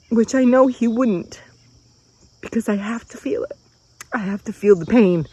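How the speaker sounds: fighting through tears while admitting it; real crying. Real, not acted. Woman confessing to viewers that she must feel the pain, crying. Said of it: "I'm sorry for crying".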